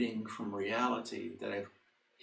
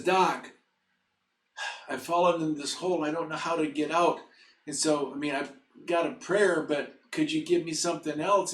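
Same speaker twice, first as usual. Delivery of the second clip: higher-pitched, mildly pleading quote; pleading patient; 6 semitones higher, 3 dB louder than usual. Retold or imitated. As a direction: lightly act a man asking for help. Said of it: "He says".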